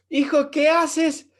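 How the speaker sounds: slow, loud, high startled question; amused father catching his son at the stall door. Retold or imitated. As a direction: stretch it out in mock alarm. Father startled by son's foot.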